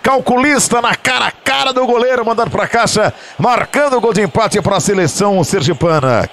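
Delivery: high, thrilled play-by-play; excited goal replay call. Real, not acted. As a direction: keep the goal euphoria going. (narração empolgada do replay)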